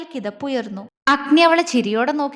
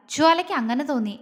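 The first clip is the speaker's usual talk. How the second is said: ordinary delivery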